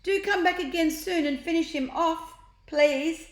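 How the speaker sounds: high, loud call. A narrator's reading. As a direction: call up to the balloon. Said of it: "called out".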